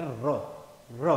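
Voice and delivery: र, र as separate rolled "ra" sounds; demonstrated R sound. Shown as a demonstration. Teacher showing how the tongue rolls, demonstrated र "ra". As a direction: roll the tongue on each isolated र. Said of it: "उससे उच्चारण होकर के निकलता है".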